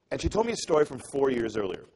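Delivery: ordinary talk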